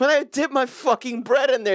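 high, hammed-up British accent; mocking a friend's slipping British accent, in a high hammed-up British voice. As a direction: take the mickey in a put-on British accent. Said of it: "like, slightly British".

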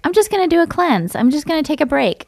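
high, breezy mock-casual; lightly mocking high singsong. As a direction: brush it off like a wellness type. Mocking those cleanse people.

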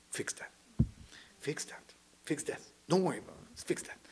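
soft, whispered repeated urging; hushed urging. Retold or imitated. Teacher quoting Rav Ashlag's advice to students, in a hush. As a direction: breathe it like a secret instruction.

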